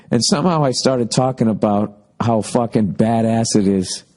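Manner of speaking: ordinary talk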